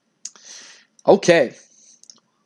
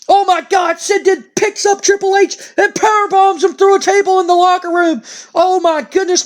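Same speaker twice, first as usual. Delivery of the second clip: much higher, shouted commentator panic; frantic play-by-play; 10 semitones higher than usual. Acted. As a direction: call the brawl like a stunned announcer. Breaking news play-by-play.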